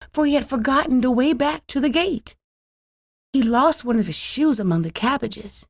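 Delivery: usual speaking voice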